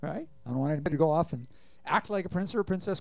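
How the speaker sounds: ordinary talk, nothing marked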